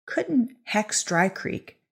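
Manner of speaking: ordinary delivery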